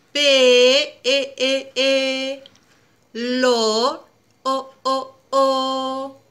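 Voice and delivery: "pe, e, e, lo, o, o" with each vowel held; stretched vowels. Shown as a demonstration. Teacher to child, lengthening the vowels of pelo. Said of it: "Ahora alarguemos la vocal".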